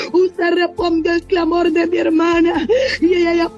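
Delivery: normal talking voice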